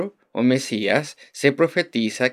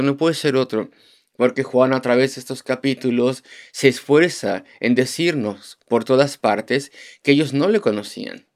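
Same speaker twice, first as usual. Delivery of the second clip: ordinary talk, nothing marked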